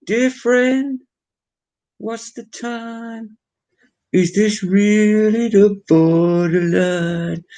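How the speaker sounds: high, slow put-on singing voice; mock old singer. Vlogger mocking an aged singer, high slow croon. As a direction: croon it like a frail old star.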